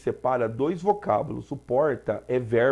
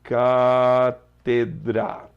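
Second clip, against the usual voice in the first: Cátedra held very slowly and louder, stress on CÁ; slow emphatic word; 6 dB louder, much slower than usual. Shown as a demonstration. Teacher stressing the root word for students. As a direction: stretch Cátedra out, pressing the first syllable CÁ. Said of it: "que é cátedra".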